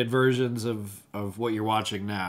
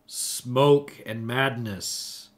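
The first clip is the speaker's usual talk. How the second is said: slow, drawn out; much slower than usual. Pretend. Drawn-out show title.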